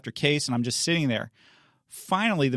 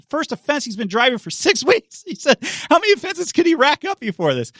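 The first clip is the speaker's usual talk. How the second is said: much higher, louder mock disbelief; incredulous judge; 13 semitones higher, 6 dB louder than usual. Judge mocks prosecutor's plea, high incredulous scoff. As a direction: scoff at the absurdity, loudly.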